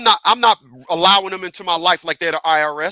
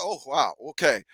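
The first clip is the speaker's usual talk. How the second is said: ordinary talk, nothing marked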